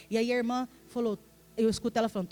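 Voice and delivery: ordinary talk, nothing marked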